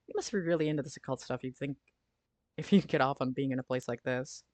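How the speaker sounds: ordinary talk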